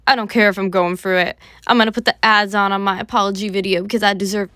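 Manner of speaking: loud, mock-defiant quote; mocking imitation. Commentator mimicking a YouTuber's apology video, loud and mocking. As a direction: mimic him brazenly, voice pushed up in volume. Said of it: "This boy's like".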